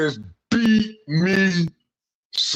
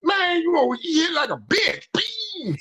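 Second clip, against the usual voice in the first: mock taunt and punch sound; 10 semitones higher than usual. Retold taunt then sound effect.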